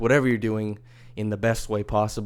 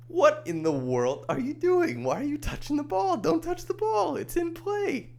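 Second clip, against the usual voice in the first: much higher put-on voice, exasperated; outraged scolding. Podcaster scolds a fan touching the live ball, high outraged put-on voice. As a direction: scold the fan like a shocked heckler.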